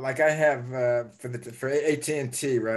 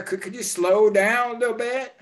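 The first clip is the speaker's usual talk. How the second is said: pleading request; 10 semitones higher, 4 dB louder than usual. Retold or imitated. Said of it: "You say".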